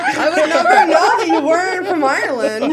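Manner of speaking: mock praise through laughter; laughing. Real, not acted. Teasing co-host about bad accent.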